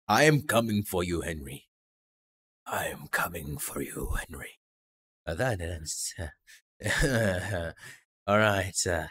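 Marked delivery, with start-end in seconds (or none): 0.1-4.6 low creepy threat, fading; menacing. Acted. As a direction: read it like a stalker's threat. Reading a menacing note aloud.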